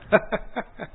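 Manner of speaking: short airy chuckle; light laugh. Real, not acted. Monk laughing after the wife says her husband is eating.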